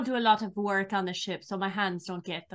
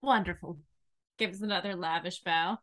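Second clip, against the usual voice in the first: ordinary talk, nothing marked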